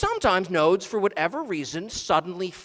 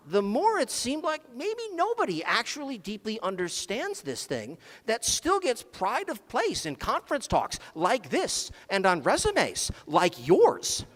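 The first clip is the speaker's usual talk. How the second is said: usual speaking voice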